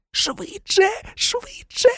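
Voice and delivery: very high, thin pleading. A narrator's reading. Hermione begging Harry to hurry, pleading. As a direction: beg in a high, desperate squeak. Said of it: "благала Герміона".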